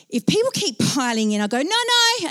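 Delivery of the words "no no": high, insistent refusal; firm objection. Retold or imitated. Nervous rider to people piling in, high insistent objection. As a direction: cut them off in a high voice.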